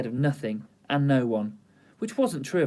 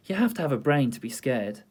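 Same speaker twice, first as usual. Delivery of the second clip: ordinary delivery, nothing marked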